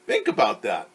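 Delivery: plain reading voice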